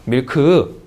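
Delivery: "밀크" in two beats, Korean style; Koreanized "밀크" with a full 크 at the end; two-syllable milk. Shown as a demonstration. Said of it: "네, 이음절".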